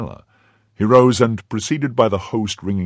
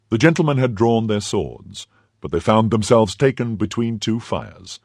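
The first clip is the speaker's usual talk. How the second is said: ordinary delivery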